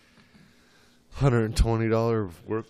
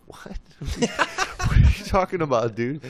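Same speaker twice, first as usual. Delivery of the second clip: high laugh breaking into the question; laughing disbelief; 6 semitones higher, 4 dB louder than usual. Retold or imitated.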